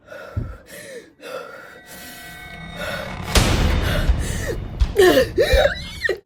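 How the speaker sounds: loud high gasping breaths; heavy panicked breathing. Retold or imitated. Seeing the ex again, loud panicked breathing. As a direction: pant loudly as if panicking. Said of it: "I'm breathing heavy as hell".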